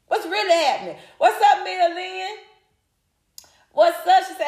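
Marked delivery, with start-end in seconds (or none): none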